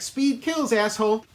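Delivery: high-pitched mimicked taunt at a driver; mock yell in a high voice; shouted taunt. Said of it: "and she's like".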